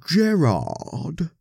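high, drawn-out posh English greeting; mock him in a swooping posh English voice; mocking posh English accent. A narrator's reading. Veronica greeting Gerard at the door, mocking in a posh English accent. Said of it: "putting on her posh English accent".

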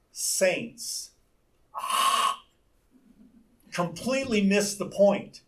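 normal talking voice

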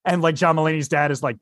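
usual speaking voice

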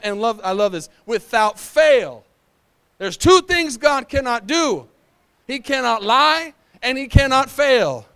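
loud, building preacher's cry; full-force preaching. Acted. Pastor rallying the church about the pandemic, loud preaching.

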